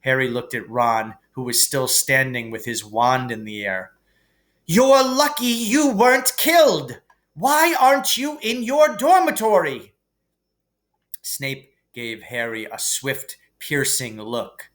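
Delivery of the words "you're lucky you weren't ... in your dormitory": high, loud, sharp scolding; a strict old professor dressing them down; stern teacher. A narrator's reading. McGonagall scolds the boys for leaving the dormitory, stern.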